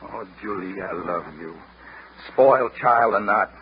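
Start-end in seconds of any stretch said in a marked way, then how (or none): 0.0-1.5 soft, low loving murmur; quiet declaration of love to his sweetheart; tender. Acted. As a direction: let the fight melt away.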